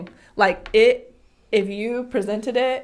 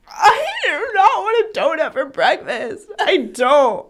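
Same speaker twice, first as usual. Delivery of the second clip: put-on high, slow, louder sulky child voice; bratty child refusal; 7 semitones higher, 6 dB louder, slower than usual. Mom mimicking daughter refusing breakfast donut. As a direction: stretch out a stubborn kid's whine.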